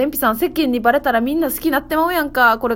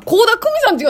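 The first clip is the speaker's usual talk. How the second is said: [name] impression; 12 semitones higher, 6 dB louder than usual. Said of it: "[name]さんでもなかったよな".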